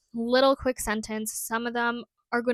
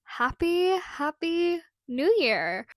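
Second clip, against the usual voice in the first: higher, louder, drawn-out festive greeting; cheery greeting; 7 semitones higher, 4 dB louder, slower than usual. Real, not acted. Greeting book lovers at New Year, slow and bright. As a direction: sing it out like a party toast.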